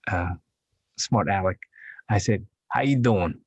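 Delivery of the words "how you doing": lightly put-on New York accent; cheeky New York greeting, pitched up. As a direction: toss it out like a smart-aleck New Yorker. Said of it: "I just came back from New York".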